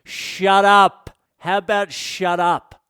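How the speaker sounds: mock overbearing boss voice; domineering boss. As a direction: imitate a boss shouting down a junior. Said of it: "whoever my boss was".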